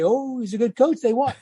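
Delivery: high mock voice of the fans praising the coach. Coach mocking fickle fans after a win, in a high sarcastic voice.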